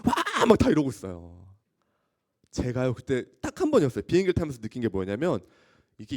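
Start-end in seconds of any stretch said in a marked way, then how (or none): 0.0-0.5 high, loud cry to any god at all; mock terrified shout; retold scream. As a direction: wail as the plane shakes. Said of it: "온갖 신을 사람들이 막 다 불러".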